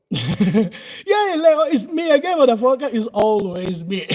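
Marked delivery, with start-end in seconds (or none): 0.1-2.9 loud, high mock-tough greeting; loud cheeky taunt to Leo. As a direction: play the swaggering buddy shouting hello. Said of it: "playful quoted greeting to Leo".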